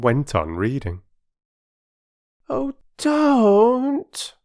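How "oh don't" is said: put-on very high, loud pleading girl; despairing plea. Phyllis begging the porter, in despair. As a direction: beg him to stop. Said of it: "said Phyllis in despair".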